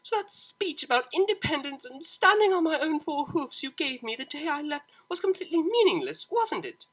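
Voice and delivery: high, loud bitter accusation; hurt accusation. Acted. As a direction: throw it back at her mother, stung. Octavia angry at mother on phone.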